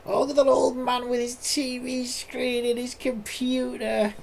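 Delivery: put-on high, slow sneering teen voice; mock a zoomer sneering at an old man. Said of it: "I'm just gonna be seen as the old man".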